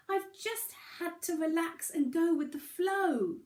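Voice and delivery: a tiny stone amazed at the ocean; small pebble voice. A narrator's reading. Pebble speaks to whale.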